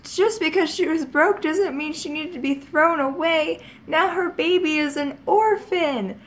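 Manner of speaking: high, loud indignant protest; indignant child. Retold or imitated. Seven-year-old scolding mom over the orphaned foal, high and loud. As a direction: argue like an upset kid.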